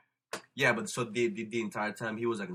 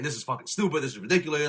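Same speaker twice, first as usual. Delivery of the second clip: mock angry rant, higher and louder; a retold cab driver ranting at a passenger; retold cabbie abuse; 6 semitones higher, 7 dB louder than usual. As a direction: imitate the cabbie yelling insults. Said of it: "verbally abusing her".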